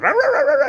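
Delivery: high, loud yappy puppy barks; imitated little dog yapping. Grandfather imitating his son's small dog barking, high yapping. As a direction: yap like a tiny excited dog.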